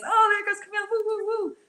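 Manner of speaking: high sing-song teasing; mock group reaction. Group chat reading her venting, high mocking whoops. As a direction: pitch way up, hooting lightly at her. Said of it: "read my writing as".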